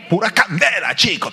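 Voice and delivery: put-on a touch of Caribbean accent; Caribbean speaker. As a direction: toss it off like a fired-up Caribbean believer. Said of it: "te dicen".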